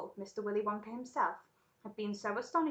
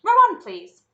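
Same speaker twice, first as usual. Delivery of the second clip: high, very loud call; called command; 11 semitones higher, 20 dB louder, slower than usual. Acted. Wonka sends the rowers onward, calling loudly. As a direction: sing out the order to the crew.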